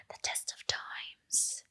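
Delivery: slow, hushed, overdone whisper; mock-dramatic slow whisper. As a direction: whisper it like a movie trailer. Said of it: "Okay, too much, right?".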